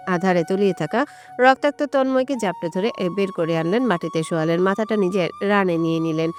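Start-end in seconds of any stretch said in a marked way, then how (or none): none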